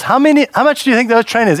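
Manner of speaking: fast, high auctioneer patter. Pretend. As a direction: rattle it off like an auctioneer. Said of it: "sound like an auctioneer".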